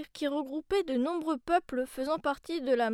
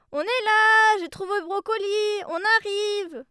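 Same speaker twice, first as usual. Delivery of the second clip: high loud call upstairs; 6 semitones higher, 8 dB louder than usual. Acted. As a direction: call up to grandma. Calling up the stairs.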